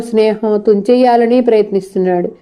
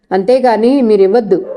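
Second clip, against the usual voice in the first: ordinary delivery, nothing marked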